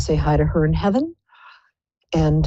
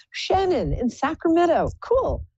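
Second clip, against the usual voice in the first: bright greeting; 11 semitones higher than usual. Real, not acted. Greeting a caller on air.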